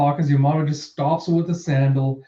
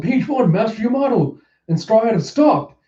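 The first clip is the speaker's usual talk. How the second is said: high-pitched report; calling out; 8 semitones higher than usual. Acted. Underling calling to Page One, high urgent report. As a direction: call it up to the boss, high.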